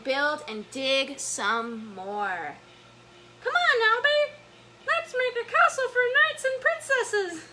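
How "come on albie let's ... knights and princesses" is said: very high, squeaky puppet voice; Elmo voice. Acted. Elmo suggesting a sandcastle to Albie, squeaky Elmo voice. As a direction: pitch it way up, louder, like a playful puppet. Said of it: "Elmo suggests".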